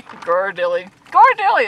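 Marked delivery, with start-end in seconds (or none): 1.1-1.7 excited. Real, not acted. Finally recalls the chocolate name.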